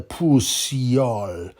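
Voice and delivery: pussy-o stretched very slow, relishing each syllable; drawn-out mock insult. Real, not acted. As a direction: drag out pussy-o like a taunt.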